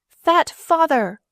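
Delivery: high, loud, slow scream. A narrator's reading.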